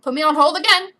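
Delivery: worked-up, punched delivery; exasperated outburst. Real, not acted. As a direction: call it out in annoyance. Exasperated first take, then repeated flatly.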